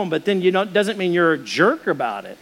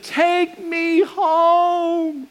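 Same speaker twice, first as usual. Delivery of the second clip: high, long-drawn imitation wail; hammed-up sobbing wife; mock crying wail; 11 semitones higher, much slower than usual. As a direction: stretch every word into a high bawl. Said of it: "she starts crying really loud".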